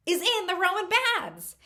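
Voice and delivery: high, punchy punchline; excited reveal. Real, not acted. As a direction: land the big reveal high. Big reveal of naked orc.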